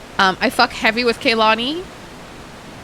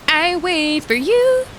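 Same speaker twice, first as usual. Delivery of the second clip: teasing sing-song; 6 semitones higher, much slower than usual. Pretend. Teasing friend in queue.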